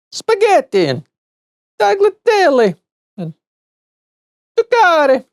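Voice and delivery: high, hammed-up Italian food words; Italian accent. Shown as a demonstration. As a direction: roll them out like a mock Italian chef. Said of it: "with an Italian accent".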